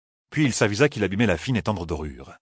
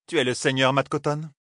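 higher queen, stress on tu; queen's voice; 6 semitones higher than usual. Acted. The queen doubts Matt's title, lightly higher queen voice. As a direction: probe him as the queen.

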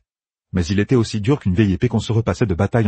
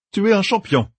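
lightly raised, eager commander; planning aloud with brisk confidence; a hint of a young general laying out plans; Matt's voice; 12 semitones higher than usual. A narrator's reading. Narrator voices Matt.

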